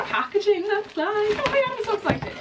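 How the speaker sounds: very high excited squeal; giddy, voice shooting way up; real excitement. Real, not acted. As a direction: squeal with excitement as the parcel appears.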